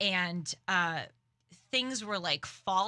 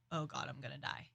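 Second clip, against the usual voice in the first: softer, lower frightened mutter under the breath; scared mutter; 6 semitones lower, 10 dB quieter, faster than usual. Retold or imitated. On the subway when a stranger starts yelling, a frightened low mutter. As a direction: mutter it like you're sure you're about to die. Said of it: "And I'm going".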